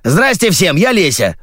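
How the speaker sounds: high-pitched, boisterous greeting; cried out. A narrator's reading. Newcomer Lesya greets everyone, crying out. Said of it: "закричало странное создание".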